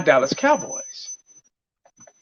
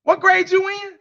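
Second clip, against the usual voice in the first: high-pitched incredulous teacher; 11 semitones higher than usual. Retold or imitated. Teacher hearing bad grammar, incredulous. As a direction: ask it high, as a baffled teacher. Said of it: "say that in front of a teacher".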